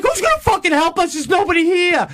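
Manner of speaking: high, panicked retort; frantic. Retold or imitated. Answering his wife on the ledge, high frantic panic. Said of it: "I'm like".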